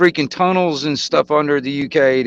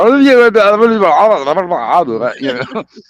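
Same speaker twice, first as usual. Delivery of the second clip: put-on mumbled drunken babble, louder; mock drunk gibberish; 5 semitones higher, 5 dB louder than usual. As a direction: imitate a drunk Irishman you can't understand. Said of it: "the Irishman out there freaking pissed".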